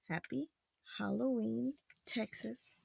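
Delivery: very quiet, separated words; feed each word gently, pausing between; soft word-by-word prompt. Shown as a demonstration. Parent prompting child to read, hushed word by word.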